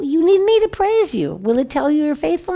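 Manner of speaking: an eager, self-centered plea; pleading bargaining. Retold or imitated. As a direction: press God as if he needs you. David bargaining with God.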